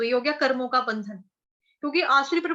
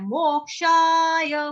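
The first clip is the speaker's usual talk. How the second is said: मोक्षाय stretched slowly and louder; drawn-out मोक्षाय; 4 semitones higher, 5 dB louder, much slower than usual. Shown as a demonstration. Teacher stressing the key word of the verse, drawn out. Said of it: "reciting the verse word".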